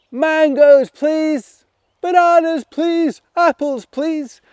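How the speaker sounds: high, loud pleading calls; loud high-pitched chorus of wishes; calling out. Acted. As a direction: call up to the tree, begging loudly. Animals calling to magic tree.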